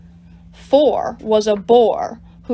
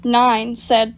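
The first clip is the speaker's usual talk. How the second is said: plain reading voice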